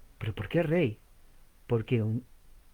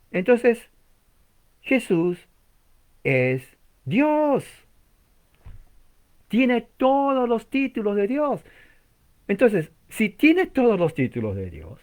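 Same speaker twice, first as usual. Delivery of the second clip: raised, louder pulpit proclamation; emphatic preaching; 9 semitones higher, 6 dB louder, slower than usual. Real, not acted. Preacher proclaiming to listeners that Jesus is God, emphatic preaching. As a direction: drive the argument home, high and forceful. Preaches his climactic argument.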